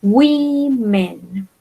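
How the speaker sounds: "women" stretched slowly as WEE-men; teacher modelling "women", syllable by syllable; pronunciation demo. Shown as a demonstration. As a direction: hold a long "ee" in "women", then let "men" fall. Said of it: "Recuerden la pronunciación".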